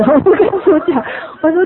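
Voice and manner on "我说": ordinary talk, nothing marked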